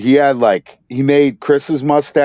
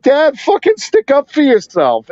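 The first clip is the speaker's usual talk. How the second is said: high-pitched exasperation at Dad; retold frustrated plea; 10 semitones higher than usual. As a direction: urge a timid father on, acted out.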